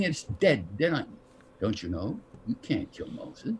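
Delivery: soft, low, calm delivery, nearly whispered at the end; a hint of a calm, unruffled Heston; calm imitation of Heston. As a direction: say it calmly, almost unvoiced. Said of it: "him just calmly looking at me".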